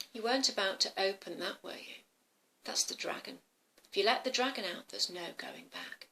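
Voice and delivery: soft, grave warning sinking to a whisper; hushed grandma warning. Acted. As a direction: caution the child very quietly. Grandma warns about the dragon.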